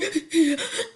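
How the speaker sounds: rising hiccupping sob half-laughed; hiccup sob. Shown as a demonstration. Demonstrating a kid's post-scolding cry, hiccup sob sound. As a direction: finish the cry-hiccup noise. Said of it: "Like that".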